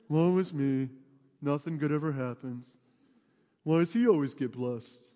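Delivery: a hint of a gloomy Eeyore mope; lightly mock a self-pitying sigh; Eeyore moping.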